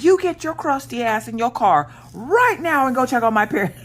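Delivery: high, forceful furious order; high-pitched angry sibling tirade; retold mad hollering. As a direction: re-enact yelling at your sister, pitched right up. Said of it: "I'm hollering at my sister".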